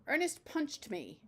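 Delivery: a lightly voiced kid, slow and whiny; a hint of a whining schoolboy; child's whine. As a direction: tattle on Ernest like a sulky boy. Kid complains to teacher.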